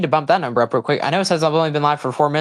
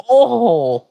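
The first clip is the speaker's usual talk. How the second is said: sudden high, loud "Oh!" of shock; shocked outcry; 8 semitones higher, 5 dB louder than usual. Real, not acted. Streamer watching a brutal suplex, shocked outcry. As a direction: react as if the move just landed hard. Big move on the apron.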